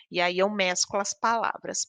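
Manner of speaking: ordinary delivery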